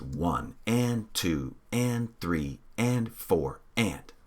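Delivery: slow, even counting of the beat; slow steady count. Shown as a demonstration. Teacher demonstrating the count to the class, slow and even. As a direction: spell out one and two and three and four and slowly, evenly. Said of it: "We're always gonna say all the numbers".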